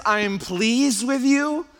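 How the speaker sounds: high, slow, rising disbelief; incredulous quoting. Acted. Preacher questions God's early approval, loud incredulous quoting. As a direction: quote it loudly as if astonished.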